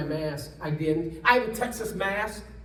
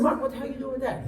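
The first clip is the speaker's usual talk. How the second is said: incredulous; 5 semitones higher, much faster than usual. Retold or imitated. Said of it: "I said".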